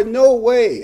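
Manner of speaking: flat-out refuse it; high loud mock refusal; emphatic no way. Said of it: "I'm putting my words".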